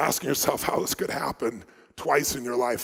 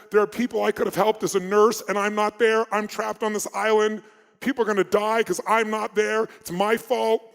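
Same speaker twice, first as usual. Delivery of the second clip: higher, louder anguished pleading; distressed confession; 6 semitones higher, 3 dB louder than usual. Retold or imitated. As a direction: voice a guilt-ridden woman pouring out. Guilty woman sharing in group.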